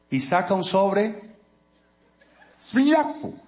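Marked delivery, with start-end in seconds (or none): 2.6-3.3 "flaco" squeaked high for disappointment; comic squeak. Acted. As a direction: pitch "flaco" way up in mock letdown. Thin envelope, comic. ("flaco" con voz aguda de decepción cómica)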